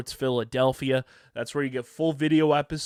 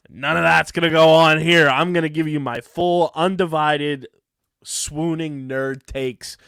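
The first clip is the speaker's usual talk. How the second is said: emphatic; 3 semitones higher, 6 dB louder than usual. Real, not acted. Promising gushing nerd takes.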